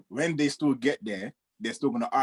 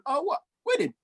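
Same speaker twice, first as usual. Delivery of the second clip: imitated screaming; 14 semitones higher than usual. DJ hearing there is a wedding to charge for, mock screaming. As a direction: act out a DJ jumping at a wedding. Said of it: "he's coming screaming".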